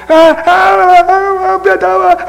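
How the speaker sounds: very high, loud mock bawling; long drawn-out pleading howls; child's wailing. Shown as a demonstration. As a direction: bawl like a child being beaten. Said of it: "所以你要会观察小孩子哭法".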